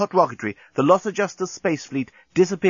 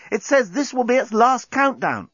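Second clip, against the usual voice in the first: high-pitched alarmed report; urgent cry; 13 semitones higher than usual. A narrator's reading. Nettie warning of the last countdown, crying out. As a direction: warn them urgently, voice up high. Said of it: "cried Nettie".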